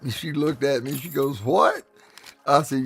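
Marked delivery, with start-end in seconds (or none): 1.4-1.8 high, sharply rising mock question; incredulous child. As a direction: imitate a girl who can't believe it. Acted child's disbelief.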